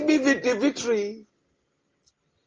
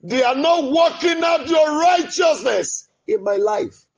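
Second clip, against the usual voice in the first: impassioned cry; 7 semitones higher than usual. Real, not acted. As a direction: protest to God at full voice. Protesting enemies to God.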